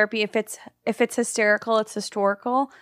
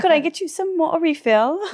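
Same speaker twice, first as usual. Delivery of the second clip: higher-pitched mock English waitress; British waitress; 6 semitones higher than usual. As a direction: offer it in a put-on British accent. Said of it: "you're a waitress in this".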